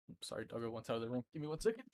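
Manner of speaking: usual speaking voice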